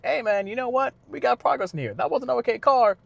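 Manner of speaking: lightly hammed-up cocky driver shrugging it off; cocky upbeat racer. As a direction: breezily brush off the crash. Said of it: "But he's like".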